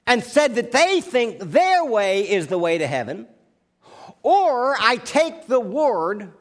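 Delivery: loud, driving pulpit delivery; full-force preaching. Acted. Preacher thundering at his congregation, full-force sermon.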